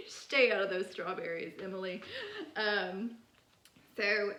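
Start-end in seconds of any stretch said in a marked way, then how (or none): 0.1-2.0 louder mock scolding as God; a hint of a stern God. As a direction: scold her like a stern father.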